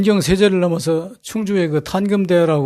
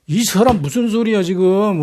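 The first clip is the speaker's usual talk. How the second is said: mock scolding; 5 semitones higher than usual. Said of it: "윽박질러서 내쫓으니까".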